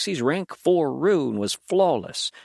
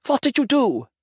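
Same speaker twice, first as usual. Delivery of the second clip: loud, high, alarmed demand; the wounded demon demanding what was done to him; 12 semitones higher, 5 dB louder than usual. A narrator's reading. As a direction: force the answer out of him. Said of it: "Mattis demanded".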